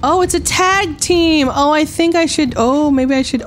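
high, flustered exclaiming, easing down; flustered excitement. Real, not acted. Player discovering it's a tag team, flustered excitement. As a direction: exclaim high, then come down as you hesitate.

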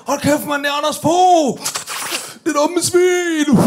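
high, loud mock shout, last words slow; Mark shouting. Friend in the car spotting the politician, yelling in disbelief. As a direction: yell it like a stunned friend. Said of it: "Og Mark, han siger".

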